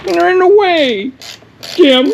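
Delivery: high, whiny fake crying; hammed-up sobbing over the armrests; mock sad. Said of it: "I'm kinda sad".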